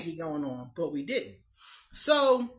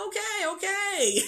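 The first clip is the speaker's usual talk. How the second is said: mock meek okay; 12 semitones higher, 10 dB louder than usual. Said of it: "So I'm like".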